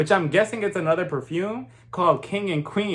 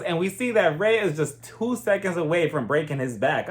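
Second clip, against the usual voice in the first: ordinary talk, nothing marked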